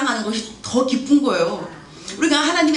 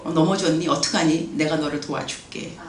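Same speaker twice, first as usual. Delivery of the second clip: tender God; 6 semitones lower, 4 dB quieter than usual. Retold or imitated. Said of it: "이러는 분은 또 아니세요".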